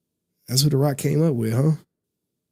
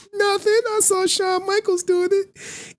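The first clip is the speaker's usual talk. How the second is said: put-on much higher, slow kid voice; acted kid voice; slower than usual. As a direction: play a naive kid answering back slowly. Kid's reply, acted high.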